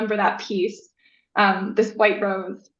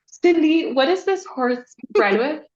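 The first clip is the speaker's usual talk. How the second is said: higher and louder, near tears with amazement; amazed question; 6 semitones higher, 5 dB louder, slower than usual. Retold or imitated. Asking her friend about the foal in the photo, awed and excited. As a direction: ask in awed disbelief.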